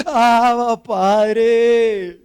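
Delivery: loud, drawn-out cry to the Father; a desperate clamor, each word stretched; anguished crying out. Acted. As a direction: cry out long and loud as if in terror. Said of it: "así como un clamor de terror, así es que clamamos".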